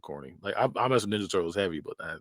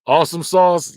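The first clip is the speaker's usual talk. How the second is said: higher, louder chirpy office voice; mock enthusiastic; 7 semitones higher, 9 dB louder than usual. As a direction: chirp it like a coworker. Mocking work phrase.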